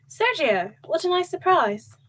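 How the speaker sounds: put-on a pleased mother greeting her son, accent light; mother surprised by her son's call, lightly accented. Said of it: "His mother's lightly accented voice said".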